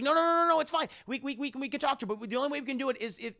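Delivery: high, insistent acted woman; frantic reassurance. Wife persuading her husband, high and frantic.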